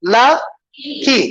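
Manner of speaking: "लकी" very slowly, in two separate beats; split into syllables. Shown as a demonstration. Teacher showing students two syllables, "लकी" drawn apart. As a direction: say "लकी" as "ल", long pause, "की". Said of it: "टू syllables".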